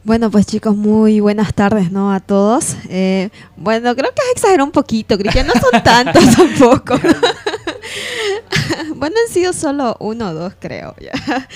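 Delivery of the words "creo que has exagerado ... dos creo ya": giggling through a tease at the host; laughing. Real, not acted. Guest laughing while teasing host Cristian about exaggerating.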